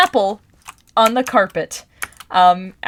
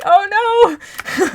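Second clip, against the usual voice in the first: much higher, laughing yelp of dismay; mock dismay; 12 semitones higher, 4 dB louder than usual. Seeing the teacher sidestep the mess, high mock yelp. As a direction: squeal it, embarrassed and amused. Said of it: "and I was like".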